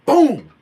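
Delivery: a big shouted boom; loud imitated boom. Landing on the box, a shouted boom. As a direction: blast out the landing sound.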